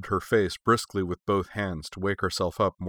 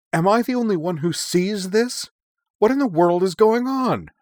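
high, loud exasperated outburst; outraged demand; 12 semitones higher, 7 dB louder than usual. A narrator's reading. Young woman demanding answers from her calm comrades, shouting. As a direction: demand it at full voice, bewildered. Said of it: "she demanded".

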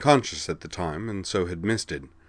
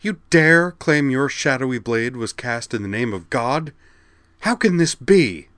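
snarling to outraged shout; 6 semitones higher, 5 dB louder than usual. A narrator's reading. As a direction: snarl building to a much higher, louder cry. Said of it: "she snarled".

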